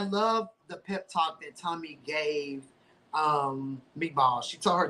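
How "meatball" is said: ordinary delivery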